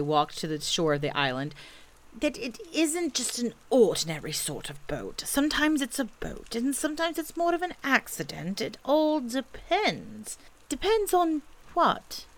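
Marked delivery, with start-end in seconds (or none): 2.1-10.4 high, hesitant bear voice; slightly sheepish, high-pitched bear reasoning; Pooh bear, explaining. A narrator's reading. Pooh explaining his boat. 10.7-12.3 high, slow baffled question; bewildered child voice; puzzled boy. A narrator's reading. Christopher Robin puzzled by Pooh.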